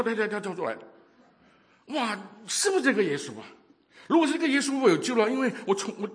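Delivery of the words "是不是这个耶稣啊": usual speaking voice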